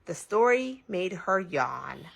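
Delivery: ordinary delivery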